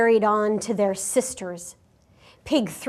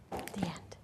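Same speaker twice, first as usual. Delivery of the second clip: soft unvoiced whisper; whispered ending; 4 semitones lower, 19 dB quieter than usual. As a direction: breathe the last words. End of story read aloud.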